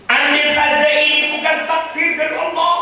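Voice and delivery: very high, loud, anguished; the Prophet mourning his dying wife; grieving cry. Acted. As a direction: wail it with grief.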